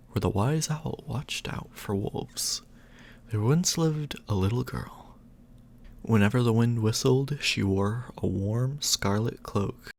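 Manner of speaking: usual speaking voice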